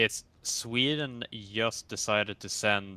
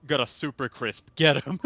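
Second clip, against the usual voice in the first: raised, mildly yelled call-out; mock yell; 3 semitones higher, 6 dB louder than usual. Alerting passengers to someone at the cockpit, mock yell. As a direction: call it out like a half-serious alarm. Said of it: "you just yell".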